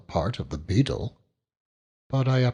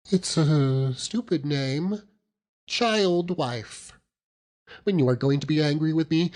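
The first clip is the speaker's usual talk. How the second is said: put-on higher, quick and coaxing young wife; pleading child wife; 7 semitones higher than usual. A narrator's reading. Dora pleading with her husband to call her child wife. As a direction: beg him gently to be forgiven.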